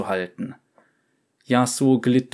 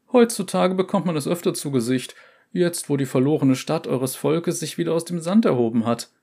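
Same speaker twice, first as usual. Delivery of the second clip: quick higher gossiping trader; merchant voice; 6 semitones higher than usual. Acted. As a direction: rattle it off like a gossip.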